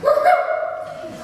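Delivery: high airy cheer of delight; a gleeful whoop at free lunch; excited whoop. Retold or imitated. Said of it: "And I was like".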